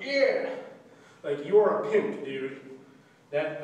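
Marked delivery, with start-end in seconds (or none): 0.0-0.5 cocky whoop. Pretend. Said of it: "you're just like".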